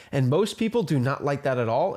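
normal talking voice

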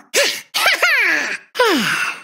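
gloating evil chuckle building to a laugh; battle-ready villain laugh. Acted. Villain calling for battle, gloating laugh. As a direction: laugh with menacing relish.

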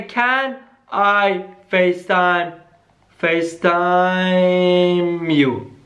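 each word stretched out very slowly while typing; slow typing-along voice; slow dictation. Acted. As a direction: drag the words out as you type them.

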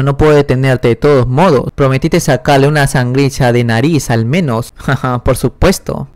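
ordinary delivery

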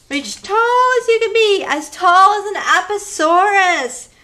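high reaching cheer; high stretching call. Shown as a demonstration. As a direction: stretch the voice up tall. Leading kids' movement game.

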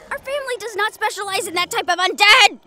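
loud, indignant protest; exasperated outburst. Acted. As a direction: bristle at the accusation. Exasperated after a sigh.

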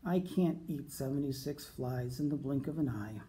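ordinary delivery

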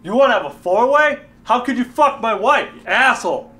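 loud, high-pitched indignation; outraged. Acted. Husband berates his friend over the affair, outraged. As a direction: throw it back at him, outraged.

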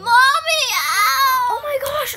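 high, loud mock pain yelp; fake pain cry. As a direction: cry out as if hurt. Fake nail-through-hand prank.

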